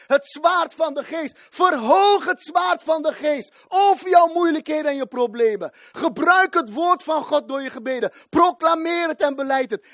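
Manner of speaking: high, loud exhortation; rally the congregation with a roar; fervent commanding sermon cry; full preaching shout. Real, not acted.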